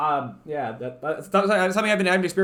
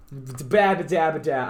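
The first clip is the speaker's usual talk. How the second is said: slow, stumbling nonsense syllables; mock stutter babble; 3 semitones lower than usual. Shown as a demonstration. Streamer showing viewers a stutter, mock stammering babble. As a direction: babble as if tripping over syllables. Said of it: "Like, I stutter".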